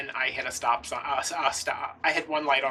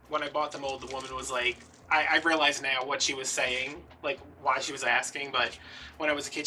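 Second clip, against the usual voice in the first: usual speaking voice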